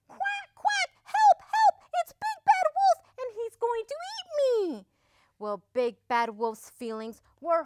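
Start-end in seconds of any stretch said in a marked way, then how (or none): 0.1-4.9 very high, loud squeal for help; panicked duckling cry. A narrator's reading. Number five fearing the wolf will eat him, panicked cry. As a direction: shriek in a tiny terrified duck voice. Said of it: "cried number five".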